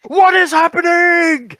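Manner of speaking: mock yell. Retelling the movie's climax, loud hammed-up cry. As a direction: ham up a panicked announcement. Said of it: "he doesn't look like he's yelling, but he's like".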